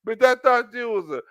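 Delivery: ordinary talk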